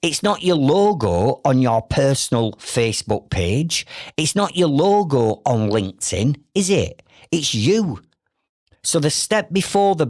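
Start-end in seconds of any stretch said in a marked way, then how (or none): none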